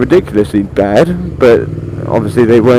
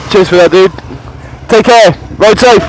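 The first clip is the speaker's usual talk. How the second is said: calling out; 8 semitones higher than usual. Real, not acted. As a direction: shout thanks to a passing rider. Calling out to passing biker.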